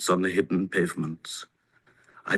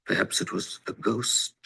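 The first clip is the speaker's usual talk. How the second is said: hushed; 3 semitones lower, 7 dB quieter than usual. A narrator's reading. Frightened boy after fleeing.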